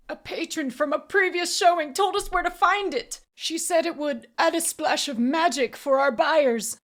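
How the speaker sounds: high, loud, anguished confession; pained, frightened. A narrator's reading. Auctioneer explaining to his interrogator, anguished. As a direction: spill it out desperately. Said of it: "twisted in pain and sadness".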